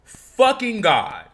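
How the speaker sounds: slow, loud, high disbelief; stunned exclamation. Real, not acted. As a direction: drag it out in shock. Stunned by the sign.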